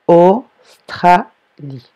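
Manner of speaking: "Australie" slowly split into au, stra, lie; loud first syllables of "Australie", then a pause before lie. Shown as a demonstration. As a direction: dictate "Australie" in separate pieces. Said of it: "première syllabe".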